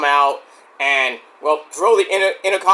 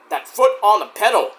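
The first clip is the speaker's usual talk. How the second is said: usual speaking voice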